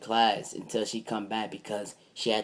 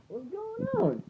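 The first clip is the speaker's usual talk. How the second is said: high confused voice. Retold or imitated. Confused narrator questions parents.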